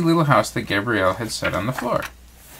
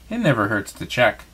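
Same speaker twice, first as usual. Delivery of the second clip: ordinary delivery, nothing marked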